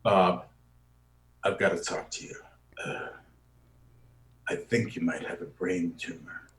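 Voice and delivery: low, soft, somber delivery; grave quiet news. Shown as a demonstration. Telling Bob he may have a tumor, low and hushed. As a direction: break bad news gently to Bob. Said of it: "and I come in and I say".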